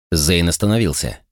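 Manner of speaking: ordinary delivery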